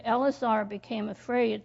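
ordinary talk

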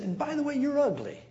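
high, mocking old man jab; mock insult. Grandpa sneering at a visitor, mock insult.

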